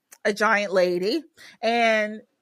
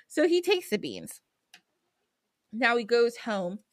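ordinary delivery

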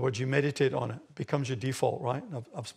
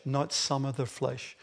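normal talking voice

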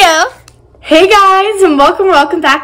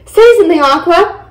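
raised, louder order; higher-pitched, insistent command; 5 semitones higher, 4 dB louder than usual. A narrator's reading. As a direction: order it to speak up. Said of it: "I commanded".

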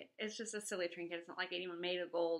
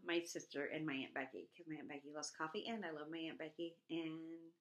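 normal talking voice

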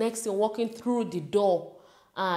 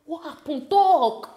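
high, pressing father, louder at end; urgent dad; 8 semitones higher, 7 dB louder, slower than usual. Retold or imitated. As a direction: demand it like a worried dad. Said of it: "He was like".

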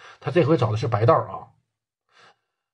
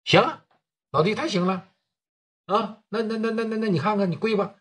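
higher, sneering mock approval; mocking taunt; 6 semitones higher, 3 dB quieter than usual. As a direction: goad him to kneel with a sneer. Du Cheng taunts him to kneel.

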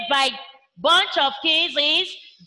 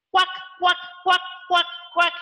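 duck quacks; faster than usual. Shown as a demonstration. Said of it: "A duck quacks".

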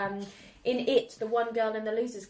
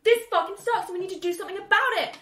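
defiant heroine; 11 semitones higher, 7 dB louder than usual. Retold or imitated. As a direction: hammer out the protest like a fired-up rebel. Heroine protests, acted.

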